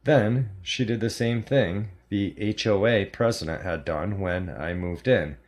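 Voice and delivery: plain reading voice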